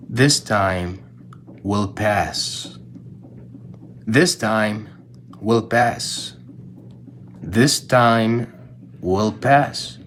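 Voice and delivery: low, measured phrases with pauses; grave, deliberate inscription reading; solemn reveal. Retold or imitated. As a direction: let each repetition sink in. Revealing the ring's inscription, repeated.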